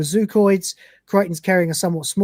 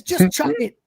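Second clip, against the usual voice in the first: urgent urging; 8 semitones higher than usual. Acted. Urging Dwayne.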